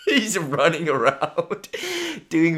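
barely holding back laughter, slow; laughing while telling. Real, not acted. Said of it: "we're dying laughing".

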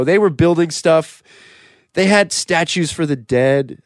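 normal talking voice

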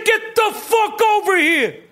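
high-pitched enraged yell; hammed-up furious boss; Danny Aiello. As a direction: order someone over at the top of the voice. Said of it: "That's Danny Aiello".

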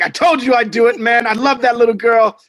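high, loud, acting out enthusiasm; retold excited boast. Dad retelling his boast to friend, excited. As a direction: replay the proud announcement with punch.

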